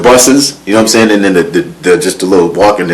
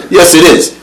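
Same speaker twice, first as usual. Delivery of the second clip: high, loud, laughing defiance; defiant laughing; 11 semitones higher, 4 dB louder than usual. Retold or imitated. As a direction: act out the argument, giggling. Acted argument with Pac.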